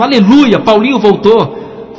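exclamação alta e aguda, arrastando no fim; grito de alegria. Retold or imitated. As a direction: grite de alegria ao ver o filho voltar. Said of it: "disse bem alto". (mãe gritando bem alto de alegria)

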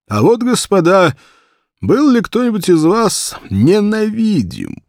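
слегка сыгранный толстый офицер, громче и выше; барин-рассказчик напористо вопрошает компанию; штаб-офицер басом. A narrator's reading. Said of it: "говоривший густым штаб-офицерским басом". (лёгкий намёк на густой офицерский бас)

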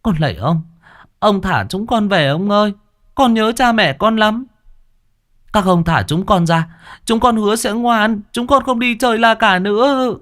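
put-on high, pleading child's voice; weak begging. A narrator's reading. Children in the cage begging the sorcerer, high and pleading. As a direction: beg like a frightened trapped child. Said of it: "yếu ớt van xin".

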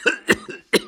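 wheezy coughing fit; old man's cough. A narrator's reading. Old husband breaks off his story, wheezy coughing fit. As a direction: cough helplessly like an old man. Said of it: "und er hustete".

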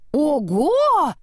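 high, swelling "oho" of surprise; amazed exclamation. A narrator's reading. Upside-down snowman gazing at the world, amazed. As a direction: marvel with a leap up in pitch. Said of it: "воскликнул снеговичок, удивлённо глядя вокруг".

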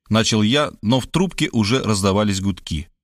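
plain reading voice

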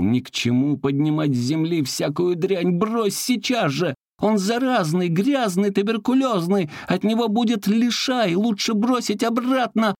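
high, shrieking protest; yelling. Acted. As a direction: yell at her to drop it. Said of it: "Саратов заорал". (высокий крик «Брось сейчас же!»)

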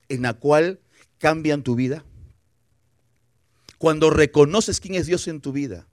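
ordinary talk

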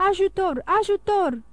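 high-pitched call for help; cry for help. A narrator's reading. Young lady ringing the alarm, crying for help. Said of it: "strigă domnișoara Darmil".

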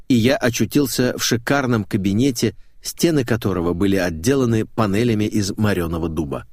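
ordinary delivery

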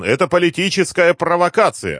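high, loud protest; отбивается криком. A narrator's reading. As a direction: shout it high while fending someone off. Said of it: "отбивался он". (высокий протестующий крик)

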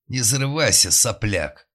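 loud contemptuous shout; angry shout. A narrator's reading. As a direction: bark it down at the upstart. Old official snaps at captain.

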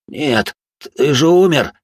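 slow, high, loud terrified cry. Acted. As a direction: cry out in horror at a ghost. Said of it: "мгновенно побелел он".

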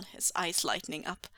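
usual speaking voice

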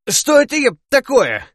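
high, loud outraged yell. Acted. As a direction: yell it out in shock. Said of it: "заорал я".

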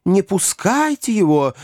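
high-pitched women's cry; squealing. A narrator's reading. Women begging others to hold him back, squealing. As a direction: squeal it in alarm, well up in pitch. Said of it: "завизжали все женщины".

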